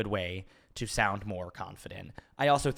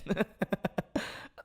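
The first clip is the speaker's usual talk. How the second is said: breathy laugh; 10 semitones higher than usual. Real, not acted. Laughs at own confession.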